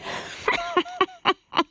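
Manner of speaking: high, ugly cackling laugh; nasty witch cackle. Acted. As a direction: cackle wickedly to yourself. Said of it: "lo hun stygt".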